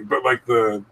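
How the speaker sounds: ordinary talk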